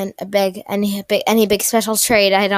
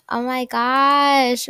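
normal talking voice

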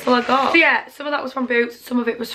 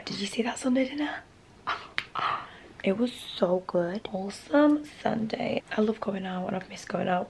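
hushed whisper to camera; soft secretive vlog whisper; 8 dB quieter than usual. Real, not acted. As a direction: whisper so as not to wake anyone.

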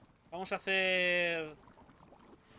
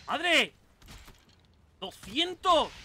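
loud, higher exclamation; real alarm, voice jumping up loud; startled outburst; 5 semitones higher, 7 dB louder, much slower than usual. Real, not acted. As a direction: burst out in shock at the attack. Startled during in-game fight.

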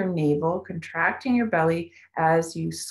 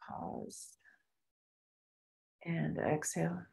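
guided meditation; 11 dB quieter than usual. Acted. Guides breath holds softly.

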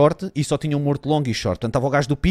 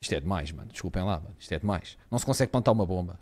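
dejected; 10 semitones lower, 13 dB quieter than usual. Real, not acted. Dejected after losing round.

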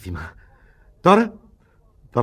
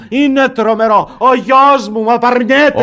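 anguished outcry; 9 semitones higher, 7 dB louder than usual. Acted. Father rejected, cries out.

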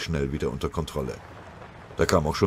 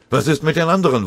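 urgent shout; 10 semitones higher, 7 dB louder, faster than usual. Acted. Shouting amid the fire.

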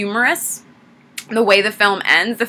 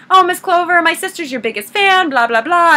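high-pitched mock fawning; gushing fan impression; 8 semitones higher than usual. Nurse asking Miss Clover for an autograph, mock gushing. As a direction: imitate a chattering starstruck nurse, pitched high.

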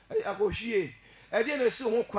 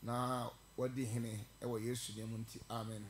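soft, low prayer voice; 6 semitones lower, 8 dB quieter than usual. Real, not acted. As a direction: pray quietly and humbly before the sermon. Opening prayer, quiet and low.

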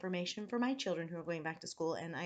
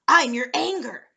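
very loud, higher creature voice; Anger creature; 5 semitones higher, 17 dB louder than usual. A narrator's reading. Red creature introduces itself to boy, booming. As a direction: boom it out as a hairy monster.

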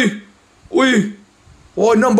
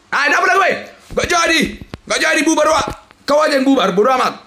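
ordinary talk

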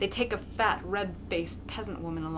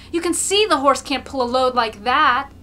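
protesting shout; 5 semitones higher, 12 dB louder than usual. A narrator's reading. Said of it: "Another adds".

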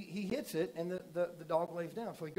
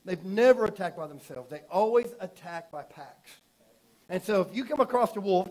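full-force preaching; 3 semitones higher, 9 dB louder than usual. Acted. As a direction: hammer the warning home to the church.

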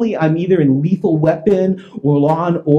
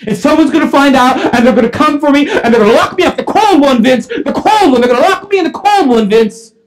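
high, loud hysterical outburst; panicked confession; 8 semitones higher, 6 dB louder than usual. Retold or imitated. Calvin telling Vince and officers, panicked shouting. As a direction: wail it in mounting terror.

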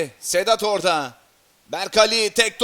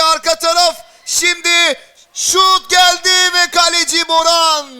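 excited commentator; 7 semitones higher, 5 dB louder than usual. Real, not acted. Dangerous attack, rising excitement.

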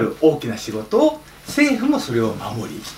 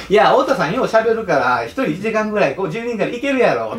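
breezy Osaka auntie egging him on; 7 semitones higher than usual. Retold or imitated. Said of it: "大阪のおばちゃんだったんですけど".

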